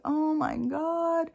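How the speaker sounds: slow, high stunned oh my God; drawn-out disbelief; high, slow dismay. Retold or imitated. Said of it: "I was like".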